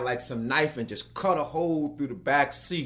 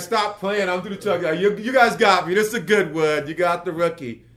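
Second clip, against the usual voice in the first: laughing it off; 5 semitones higher, 9 dB louder than usual. Retold or imitated. Thinks crew pranks him.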